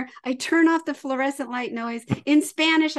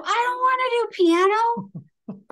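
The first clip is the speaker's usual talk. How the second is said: put-on high, louder whine of a stubborn child; whiny kid; 11 semitones higher, 5 dB louder than usual. Mom imitates son refusing piano, high whine. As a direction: drag it out like a pouting kid. Child voiced in mom's story.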